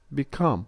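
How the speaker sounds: light "be", then punch "come" in "become"; stress on the stem. Shown as a demonstration. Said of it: "the stress is kept on the original word".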